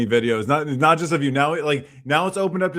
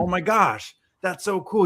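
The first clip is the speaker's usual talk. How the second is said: imitating a pleasantly amazed reaction, higher pitched; lightly acted jazzed-up enthusiasm; 5 semitones higher than usual. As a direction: retell someone's excited discovery. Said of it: "he gets all jazzed up".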